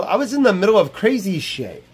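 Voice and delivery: ordinary talk, nothing marked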